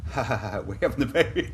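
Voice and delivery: mock scoffing laugh before the words; mocking laughter. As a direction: imitate colleagues laughing at the idea. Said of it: "And they went".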